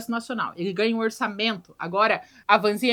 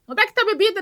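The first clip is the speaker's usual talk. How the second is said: put-on girly woman's voice; 9 semitones higher, 3 dB louder, faster than usual. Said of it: "você escuta uma voz de mulherzinha".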